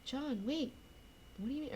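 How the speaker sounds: soft, high, plaintive call; anxious appeal. Retold or imitated. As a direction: lightly act out a small, uncertain plea. Acting Adrian calling after John.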